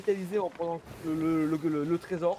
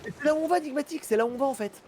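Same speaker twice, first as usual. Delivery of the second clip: excited; 7 semitones higher than usual. Real, not acted. Excited discovery on map.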